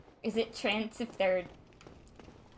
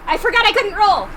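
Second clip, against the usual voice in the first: higher, louder frustrated cry; exasperated outburst; 11 semitones higher, 13 dB louder than usual. Real, not acted. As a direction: blurt it out in exasperation. Outburst after a mistake.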